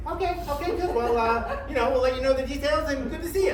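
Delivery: high, hurried mock politeness; high-pitched, hasty goodbye; flustered brush-off. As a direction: imitate someone awkwardly rushing out of a meeting. Flustered Jay hurrying to end meeting.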